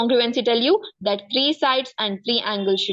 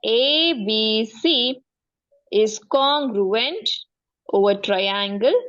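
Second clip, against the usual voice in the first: slow dictation; much slower than usual. Shown as a demonstration. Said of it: "dictating the notation slowly".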